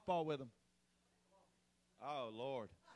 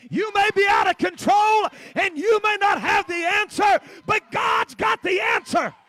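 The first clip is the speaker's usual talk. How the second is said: shouted sermon, much higher and louder, slowing on the last lines; preaching at full force; 9 semitones higher, 17 dB louder than usual. Acted. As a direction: hammer home the victory.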